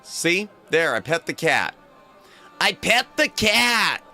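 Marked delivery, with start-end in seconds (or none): none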